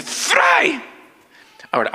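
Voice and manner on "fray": high, drawn-out "Fray" to grab attention; urgent call. Retold or imitated. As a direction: call out "Fray" high, tugging his arm. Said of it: "me agarra del brazo y me dice". ("Fray" agudo y llamativo)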